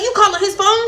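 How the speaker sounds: high, loud mock of her demand; mimicking the angry girl. The girl at the window, high mock demand. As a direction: push the question up high and loud. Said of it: "She like".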